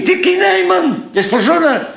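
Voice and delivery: high, giggling admission; laughing confession. Acted. Confessing Dickie Nijman was invented, laughing. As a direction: laugh as you let the secret out.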